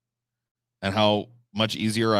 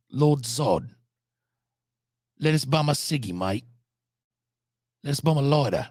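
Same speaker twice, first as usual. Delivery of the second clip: hammed-up Aussie scrounger; higher-pitched cartoon Aussie twang; mock Australian accent; 3 semitones higher than usual. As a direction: play a broad Australian cadging a smoke. Riffing Aussie slang in put-on voice.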